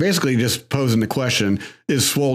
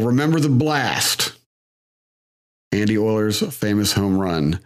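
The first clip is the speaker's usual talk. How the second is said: sarcastic; 2 semitones lower than usual. Real, not acted. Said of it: "how sarcastic I'm being with the title".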